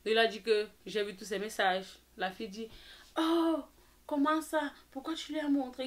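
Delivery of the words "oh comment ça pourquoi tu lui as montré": higher-pitched mimicry of a caught-out friend; mock dismay. Friend protesting that he showed the messages, mock dismay. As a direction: imitate the friend's high, startled protest.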